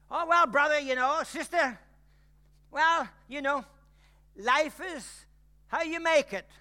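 high, loud mocking platitude voice; mocking people. Mimicking people who say life is how you make it, mocking. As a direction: parody a smug well-meaning churchgoer. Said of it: "people say to you".

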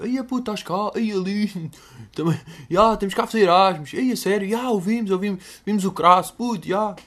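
high, excited voices greeting a famous face; excited tugas abroad. Retold or imitated. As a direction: squeal with delight at meeting him abroad. Retold Portuguese tourists meeting him.

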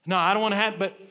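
loud, high, emphatic preacher delivery; full-force preaching. Real, not acted. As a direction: proclaim it to the whole congregation.

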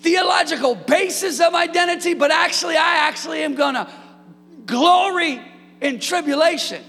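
high, loud shouted preaching; preaching at full force. Acted. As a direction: lift the room to its feet, shouting. Preaching climax.

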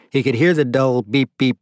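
plain reading voice

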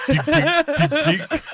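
high, short repeated dinks; imitated reversing beeps. Co-host riffs on backing up, with mimicked beeps. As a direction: beep like a backing-up vehicle.

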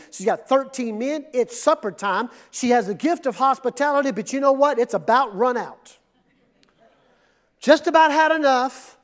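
usual speaking voice

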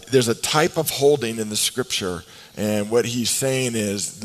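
ordinary talk

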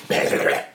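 sputtering cough mid-word; choking on a sip of tea. Real, not acted. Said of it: "What was that noise?".

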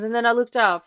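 normal talking voice

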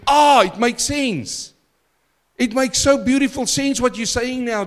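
a sudden "aha" of delight; delighted realization. Retold or imitated. Preacher reliving his study breakthrough, excited realization.

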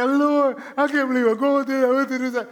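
high, loud wailing complaint to the Lord; mock crying. Preacher imitating himself crying out to God in crisis. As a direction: ham up a tearful breakdown. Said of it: "Now, I'm crying".

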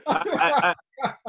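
high, breaking laugh through the words; real laughter cracking the voice high. Real, not acted. Laughing at himself about yo-yo dieting, high laughter. Laughing at his own eating habits.

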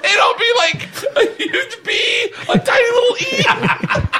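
high-pitched laughing delivery. Real, not acted. Joking with friends about skywriting, laughing high. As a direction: talk high through the laughter.

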